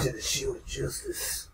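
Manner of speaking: slow whisper through clenched teeth; through gritted teeth. Retold or imitated. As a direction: hiss it through your teeth. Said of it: "talks through his teeth".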